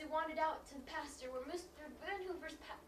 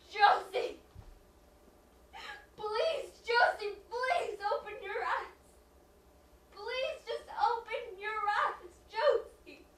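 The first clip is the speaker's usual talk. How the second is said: high, loud, anguished begging; tearful pleading; 8 semitones higher, 14 dB louder, much slower than usual. Acted. Mother begs injured child to wake, tearfully pleading. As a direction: beg slowly through tears, drawing out the words.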